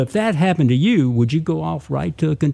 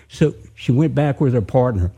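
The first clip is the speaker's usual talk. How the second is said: plain reading voice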